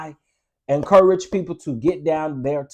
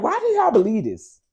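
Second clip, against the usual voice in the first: incredulous; 9 semitones higher, 3 dB louder than usual. Retold or imitated. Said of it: "I was like".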